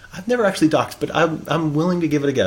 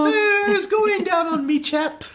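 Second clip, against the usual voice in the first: very high mock-British squeal; piping, cartoonish British voice; British accent. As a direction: ham up a British chap in falsetto. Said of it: "should we do it in a British accent?".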